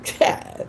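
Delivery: short unvoiced scoff of a laugh; breathy laugh. Real, not acted. After the disbelieving Really.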